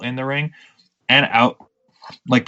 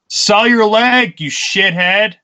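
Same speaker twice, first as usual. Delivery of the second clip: raised, higher mock yell; imitating heckling fan; 10 semitones higher, 4 dB louder, slower than usual. Wrestler retelling a fan's heckle from his chair, mock yell. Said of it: "the fan yells from his chair".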